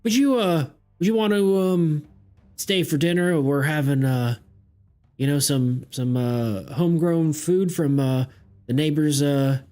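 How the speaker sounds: put-on high, hospitable old farmer. Farmer inviting the player to dinner, high friendly voice. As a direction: invite the guest to stay for dinner.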